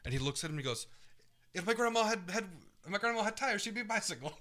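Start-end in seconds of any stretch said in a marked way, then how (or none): 1.5-4.3 Italian guy impression. Retelling how the Italian chef answered the British lady, in a mock Italian accent. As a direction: ham up an Italian chef's retort. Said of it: "That's the way he said it".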